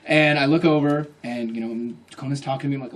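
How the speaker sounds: ordinary talk, nothing marked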